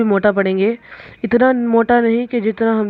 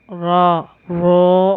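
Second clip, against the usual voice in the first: ordinary talk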